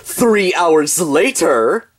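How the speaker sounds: drawn-out announcer reading a time skip; slow title card. Acted. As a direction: stretch each word like a caption.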